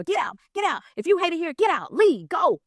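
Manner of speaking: high, punchy retold order to leave; acted angry dismissal. [name] pushes his assistant out the door, acted angry dismissal. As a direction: imitate someone throwing a person out. Boss throwing crying employee out.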